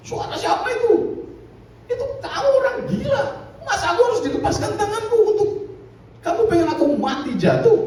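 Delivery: put-on high, fast, laughing drunk protest; a tipsy man scoffing in disbelief; drunk man's reply. Drunk answers the mystery voice. (pemabuk memprotes sambil tertawa)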